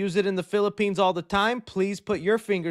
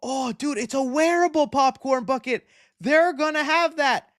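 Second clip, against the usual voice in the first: excited quote; 7 semitones higher than usual. Retold or imitated. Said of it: "I'm like".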